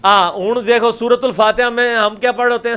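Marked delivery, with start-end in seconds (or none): none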